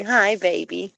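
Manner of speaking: ordinary talk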